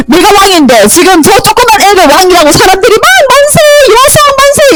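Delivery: high, agitated rant; mock the cheer in a high, worked-up voice; indignant queen. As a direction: act out an outraged usurper.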